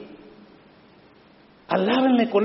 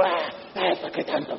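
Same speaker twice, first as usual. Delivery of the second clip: whiny complaint; 3 semitones lower, 8 dB quieter than usual. Retold or imitated. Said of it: "se enojó".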